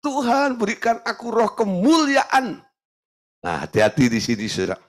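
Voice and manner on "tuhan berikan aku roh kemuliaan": high, pleading prayer voice; a lightly played plea to God; mock prayer. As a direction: imitate a believer begging God. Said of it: "minta untuk mendapatkan".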